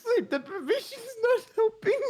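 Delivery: high, loud, breathless laughing; helpless laughing. Real, not acted. Sleep-deprived friend losing it, helpless high laughing. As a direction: wheeze it out mid-laugh. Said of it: "struggling to breathe somewhat".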